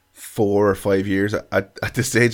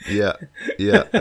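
ordinary talk, nothing marked

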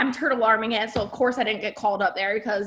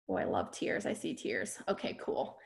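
soft aside; 13 semitones lower, 11 dB quieter than usual. Real, not acted. Aside to crying audience.

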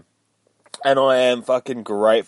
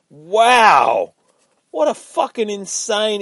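loud, high burst of amazement; excited wow; 10 semitones higher, 7 dB louder, much slower than usual. Real, not acted. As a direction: blurt it out, blown away by the issue. Host reacts after finishing comic.

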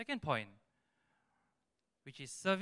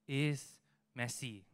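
normal talking voice

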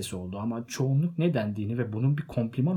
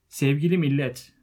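loud, higher address to a crowd; speech opening; 4 semitones higher, 7 dB louder than usual. A narrator's reading. Bilbo opening his birthday speech to the guests, loud public address. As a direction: call the gathering to attention.

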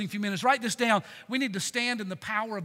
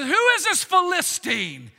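defiant challenge; 4 semitones higher, 8 dB louder than usual. Retold or imitated. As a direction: throw the challenge at the giant. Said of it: "He said".

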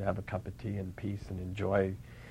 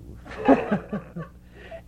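high breathy laugh; 11 semitones higher, 4 dB louder than usual. Real, not acted. Laugh at story punchline.

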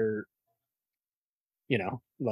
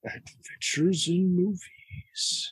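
slow, higher, booming trailer announcer; movie trailer voice; 8 semitones higher, 3 dB louder, much slower than usual. Shown as a demonstration. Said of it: "I'm just doing a movie trailer voice for you".

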